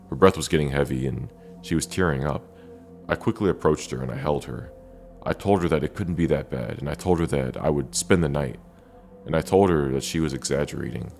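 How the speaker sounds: plain reading voice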